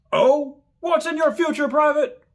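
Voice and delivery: high, loud mocking bark; drill sergeant taunt. Drill sergeant taunts private, high loud bark. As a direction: taunt him at full volume.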